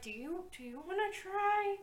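high, tentative nudge; nudging suggestion. Acted. Irina nudging Sabrina to try, coaxing. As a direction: float it up high as a coaxing question. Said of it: "nudges Sabrina and is like".

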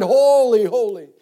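loud, high holy; cried out. A narrator's reading. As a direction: shout it like the seraphim. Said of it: "And they cried".

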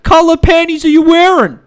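loud fake-earnest office guy turning lewd; mock creepy coworker. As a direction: push it loud and high as the overeager coworker. Acting out the creepy coworker's phone call.

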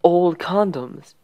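emphatic disbelief. Real, not acted. Listener reacting to a reckless plan, slow emphatic disbelief. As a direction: spell it out word by word, slowly. Lecturing about an absurd plan.

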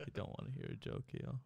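lightly put-on [name] voice, mostly air; a hint of a [name] impression, teasing a friend. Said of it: "Stop doing that d-, voice. / You're not corpse".

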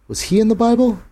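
put-on boy voice. A narrator's reading. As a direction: ask like a wondering child. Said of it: "Jake asks in story".

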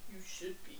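low whispered impression; Edward. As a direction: whisper it low as the unmoved boyfriend. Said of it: "Edward's just standing there like this".

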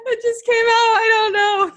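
high-pitched giggling through words; laughing. Real, not acted. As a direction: laugh it out, shocked at yourself. Caught off guard laughing.

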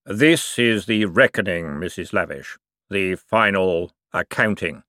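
slow, grave voice of Death. Acted. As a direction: let every word fall slowly and solemnly. Death announces the reckoning.

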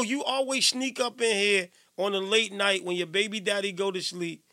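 normal talking voice

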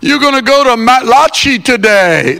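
high, loud dopey assistant imitation; mock Siri. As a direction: mimic the assistant saying something stupid. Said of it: "he says something stupid like".

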